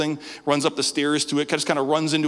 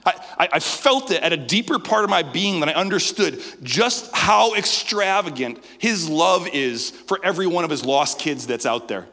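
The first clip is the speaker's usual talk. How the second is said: building sermon emphasis; 4 semitones higher, 3 dB louder than usual. Acted. Preaching climax.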